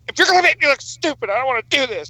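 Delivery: mocking wife. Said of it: "She's like".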